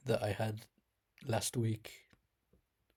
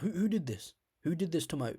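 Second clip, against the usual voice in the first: high-pitched outraged demand; enraged; 6 semitones higher than usual. Retold or imitated. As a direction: act out the fury at the ruined bake. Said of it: "I was just enraged".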